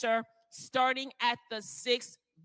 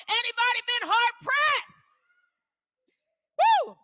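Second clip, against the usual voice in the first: much higher and louder, building to a whoop; rising preacher cry; 10 semitones higher, 6 dB louder than usual. Real, not acted. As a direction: lift the church to its feet.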